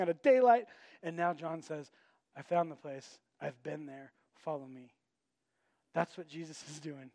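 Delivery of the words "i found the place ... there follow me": softer, lower, calm and sure; calm reassurance. Retold or imitated. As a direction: say it quietly, like a trusted guide. Retelling John's words as reassurance.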